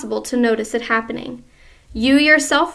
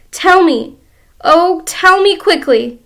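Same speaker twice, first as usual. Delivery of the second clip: higher, louder plea; desperate begging; 6 semitones higher, 8 dB louder than usual. Acted. Wife begging husband for the cure, higher and louder. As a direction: beg him urgently.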